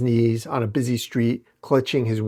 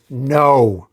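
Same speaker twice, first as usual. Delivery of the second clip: defiant no; 6 dB louder than usual. Acted. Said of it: "a defiant".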